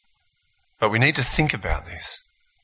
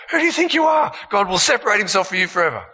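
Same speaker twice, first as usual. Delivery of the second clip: high, fast, scornful accusation; accusing tempter; 7 semitones higher, faster than usual. Retold or imitated. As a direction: hurl the charge at them. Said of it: "on the way out, he goes".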